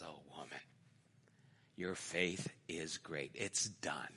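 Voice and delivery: very soft, low and gentle; tender blessing. Retold or imitated. Jesus answers the woman of great faith, hushed and tender.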